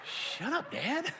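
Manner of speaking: put-on high, soft sulky kid voice; mock a pouting child answering back. Kid answering his snarky dad, sulky.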